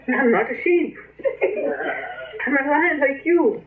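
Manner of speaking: high, excited cries with laughter; joyful realization. Acted. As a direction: shout with delight at who you are. Sheep realizes it is a lion.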